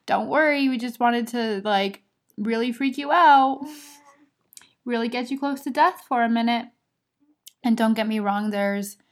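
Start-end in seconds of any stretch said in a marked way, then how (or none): 0.0-6.7 playful talking uterus. Retold or imitated. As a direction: voice the uterus as a teasing prankster. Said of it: "then my uterus decided".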